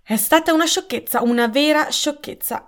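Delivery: put-on put-out elderly lady's reproach; vexed scolding. A narrator's reading. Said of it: "schioccò la lingua con aria contrariata".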